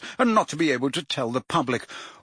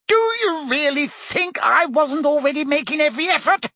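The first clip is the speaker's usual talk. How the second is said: much higher, indignant challenge; bristling, wounded indignation; heated demand; 13 semitones higher than usual. A narrator's reading. Fudge hits back at the Prime Minister, heated and indignant. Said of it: "demanded Fudge heatedly".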